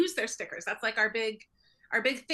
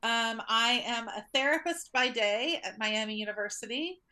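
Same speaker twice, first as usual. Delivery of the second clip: ordinary talk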